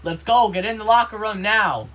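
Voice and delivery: loud, high, impatient command; teacher's barked order. Retold or imitated. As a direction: bark it like a drill-sergeant gym teacher. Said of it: "but he said to me".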